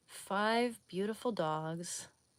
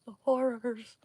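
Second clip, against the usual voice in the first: hammed-up dramatic echo, higher, louder; mock horror; 5 semitones higher, 6 dB louder than usual. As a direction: ham up the dread like a horror trailer.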